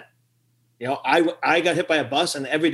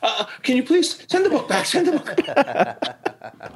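laughing mock plea; 9 semitones higher, slower than usual. Mother calling bishops, laughing.

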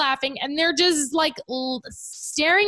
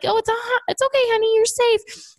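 much higher, soothing coo; soothing parent; 11 semitones higher, faster than usual. Retold or imitated. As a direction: reassure a crying toddler. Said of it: "we must tell her".

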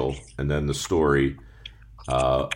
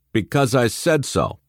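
stern parent; 7 semitones higher than usual. Retold or imitated. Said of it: "phrases like".

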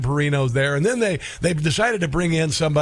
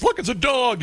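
silly clown; 8 semitones higher than usual. Retold or imitated. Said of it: "party clown would deliver".

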